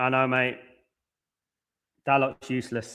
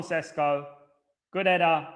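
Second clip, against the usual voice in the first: ordinary talk, nothing marked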